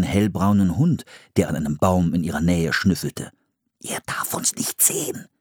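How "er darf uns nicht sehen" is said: urgent whisper. Acted. Philipp whispering a warning to Anne about the dog. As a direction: hiss a warning without voice. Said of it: "flüsterte Philipp".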